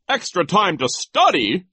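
high, loud approval; pompous delight; gleeful vice principal. Acted. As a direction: applaud it with relish. Voices delighted Nero.

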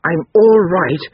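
high, loud, drawn-out insistence; insistent little boy. A narrator's reading. Boy mastering his sorrow, slow and insistent. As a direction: declare it slowly and firmly, as a child. Boy fiercely regains control.

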